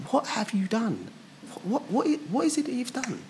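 curious Pilate. Retold or imitated. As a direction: lean in, puzzled and fascinated.

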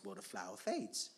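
normal talking voice

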